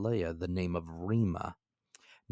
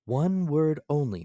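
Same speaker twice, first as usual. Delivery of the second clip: narrator's own voice; 8 semitones higher, 7 dB louder than usual. A narrator's reading. Narrator addresses Ned.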